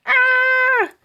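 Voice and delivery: put-on terrified boy scream; high scream. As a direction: shriek in fright. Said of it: "Stink screamed".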